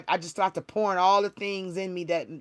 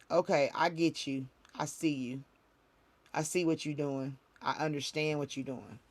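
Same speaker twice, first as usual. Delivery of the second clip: quiet understanding; 3 semitones lower, 7 dB quieter than usual. Retold or imitated. Quiet acceptance answering God.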